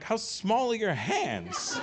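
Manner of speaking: high, drawn-out comic disbelief; hammed-up astonished jeer; mock incredulous. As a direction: stretch the question in teasing amazement. Retold teasing disbelief at tiny ring.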